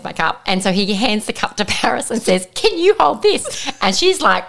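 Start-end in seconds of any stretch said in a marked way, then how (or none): 2.5-3.7 slow, high-pitched imitation of a boy; child's request. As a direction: imitate a kid asking, drawn out. Voices son handing over cup.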